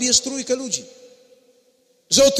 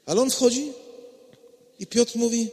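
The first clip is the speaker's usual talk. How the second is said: ordinary talk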